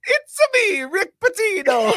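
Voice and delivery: very high, slowed cartoon Italian; cartoonish high Mario voice; Mario-style Pitino. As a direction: squeal it like a video game plumber. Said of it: "Fucking Mario sounds for fucking Patino".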